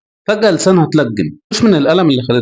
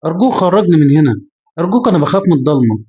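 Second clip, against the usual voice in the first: usual speaking voice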